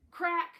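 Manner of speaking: sharp, loud crack like a gunshot. Acted. Narrator to listeners, mimicking a sudden gunshot sharply. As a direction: bark out the crack of a gun.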